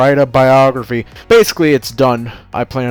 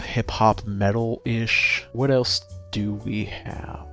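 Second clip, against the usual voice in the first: normal talking voice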